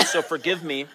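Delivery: words round a crunched mint; crunching a mint. Real, not acted. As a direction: mouth full of mint. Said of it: "I'm just crunching on a mint".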